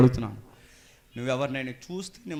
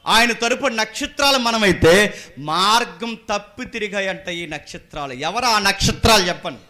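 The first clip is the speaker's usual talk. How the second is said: loud, raised-pitch pulpit proclamation; full-force preaching; 9 semitones higher, 15 dB louder than usual. Acted. Preacher asking the church about fallen stars, full-force preaching. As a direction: call each name out hard to the crowd.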